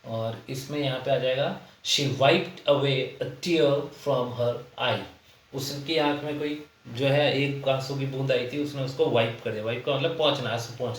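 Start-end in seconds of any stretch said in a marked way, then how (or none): 1.8-5.0 slow, word-by-word dictation of the sentence; slow example reading. Shown as a demonstration. Teacher reads the example sentence slowly to students. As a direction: read it out slowly for the class to follow. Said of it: "reads example sentence slowly".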